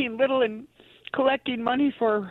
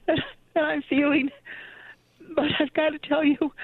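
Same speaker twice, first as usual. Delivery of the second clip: holding back tears; 5 semitones higher than usual. Real, not acted. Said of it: "I'm trying not to cry".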